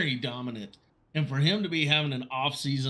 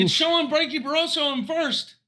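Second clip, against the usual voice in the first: high, loud, thrilled announcement; excited report; 12 semitones higher, 5 dB louder than usual. Real, not acted. Announcer seeing Barroso on top, excited report. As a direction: blurt the result with rising hope. Provisional result shows favorite winning.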